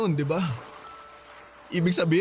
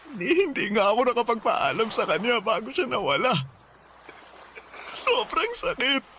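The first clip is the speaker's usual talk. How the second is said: grief-stricken; 6 semitones higher than usual. Acted. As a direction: hold back tears as you speak. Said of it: "Iiyak mo lang, Danilo".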